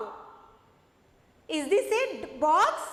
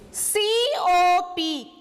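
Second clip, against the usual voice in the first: slow spelling; 4 dB louder, much slower than usual. Shown as a demonstration. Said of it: "Okay, say C O P".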